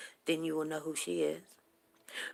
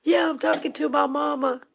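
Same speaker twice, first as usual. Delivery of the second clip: high, loud reply to a stranger; daughter answering; 10 semitones higher, 10 dB louder than usual. Retold or imitated. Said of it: "And my daughter said".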